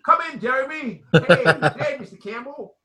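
put-on loud, high Welsh accent greeting. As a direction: play a cheery Welsh host with a Welsh accent. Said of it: "a little Welsh accent there".

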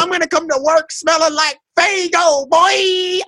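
high, drawn-out whoop of words; gleeful holler. Real, not acted. Fan boasting about vacation plans, gleeful holler. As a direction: stretch it out high, exulting.

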